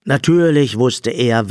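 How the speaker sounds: ordinary delivery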